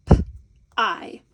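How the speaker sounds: P-I as a bare "p", pause, then "eye"; broken into sounds. Shown as a demonstration. Teacher breaking the syllable into smaller components, sounded out. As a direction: give P-I slowly as two separate sounds. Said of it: "break it down into the smaller components".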